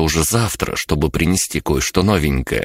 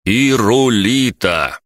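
«Ирулита» said louder and slowly, high and drawn out; 7 semitones higher, 4 dB louder, much slower than usual. A narrator's reading. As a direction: stretch «Ирулита» slowly, louder, for a deaf ear. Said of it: "повторил я громче".

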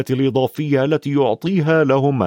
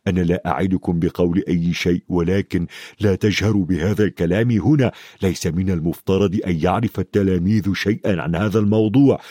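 low-pitched big man cautioning; secretive Hagrid; 4 semitones lower than usual. Acted. Hagrid warns the children not to talk here, low and guarded. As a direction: warn them confidentially in a deeper voice.

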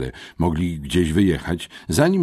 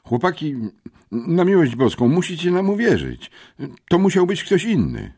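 pleading; 14 semitones higher, 6 dB louder than usual. A narrator's reading. Frightened boys plead innocence.

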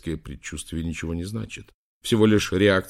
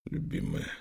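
low, hushed murmur to a beloved; whispered; 12 semitones lower, 8 dB quieter than usual. A narrator's reading. Prince overcome with feeling greets his beloved, whispering. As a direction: drop right down to an intimate whisper. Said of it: "прошептал он".

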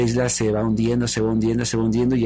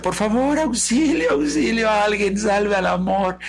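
high, wailing plea; theatrical cry for help; 8 semitones higher, slower than usual. Acted. Love calling to another boat, theatrical cry. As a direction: call out to be saved, stretching the end. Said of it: "le agarra y grita".

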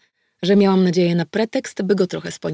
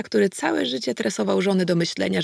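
ordinary delivery

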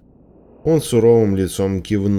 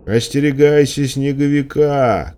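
shouted warning; 7 semitones higher than usual. A narrator's reading. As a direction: call out a warning without full volume. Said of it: "крикнул он".

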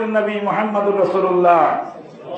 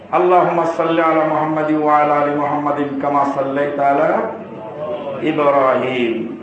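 lower, even intoned prayer; prayer recitation; 6 semitones lower than usual. Acted. Preacher quotes the durood to the congregation, low intoned recitation. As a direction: intone it evenly and stretch the final name.

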